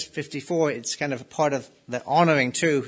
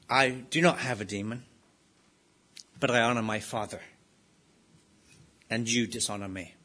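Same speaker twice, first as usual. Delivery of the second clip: calm, collected; 5 semitones lower, 6 dB quieter than usual. Retold or imitated. Replying to his accusers, calm and collected. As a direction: lower and softer, unhurried and composed. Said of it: "Calm, collected, profound".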